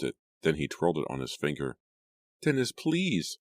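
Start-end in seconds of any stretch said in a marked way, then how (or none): 2.4-3.4 very high, drawn-out plea; begging. A narrator's reading. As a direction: plead in a high girlish character voice. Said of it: "Melody begged from the doorway".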